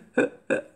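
a sharp, breathless intake of air; imitated gasp. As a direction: mimic a man catching his breath mid-sob. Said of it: "like this, like when you, when you lose your breathing".

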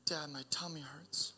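put-on whispered small-child complaint; whispered little girl. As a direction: whisper it like a toddler with a tummy ache. Daughter repeats complaint.

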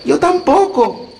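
put-on high, slow amazed girl; little girl voice. A narrator's reading. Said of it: "añadió Gretel".

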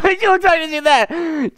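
high, laughter-filled voice; laughing while talking. Real, not acted. Reviewer cracking up over a film scene, laughing. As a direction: speak right through a fit of laughter.

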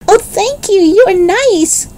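put-on very high, gushing little boy; delighted cry. A narrator's reading. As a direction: squeal your thanks, drawing out the end. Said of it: "cried Benny".

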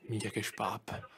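grumbled to himself; muttered aside. Real, not acted. Streamer grumbling about his friend after hanging up, muttered.

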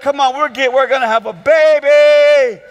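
put-on loud, gleeful baby announcement; excited celebration. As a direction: shout the happy news to the family. Acting out parents celebrating the news.

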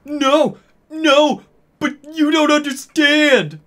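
very high, loud, desperate plea; hammed-up frantic protest; pleading. A narrator's reading. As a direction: beg in a squealing high voice. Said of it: "Draco pleaded".

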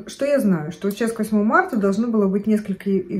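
usual speaking voice

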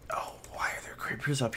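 hushed, alarmed whisper. Real, not acted. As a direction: whisper so the creepers won't hear. Spots creepers in game.

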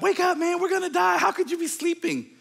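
frantic disciple; panicked cry. Retold or imitated. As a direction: shake him awake in terror. Panicked disciple wakes Jesus.

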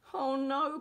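high, loud storybook cry; animated wail of dismay. A narrator's reading. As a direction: cry out in acted alarm. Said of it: "cried Zack".

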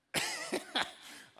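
high breathy burst of laughter; big laugh. Real, not acted. Pastor laughing at his own story, big breathy laugh.

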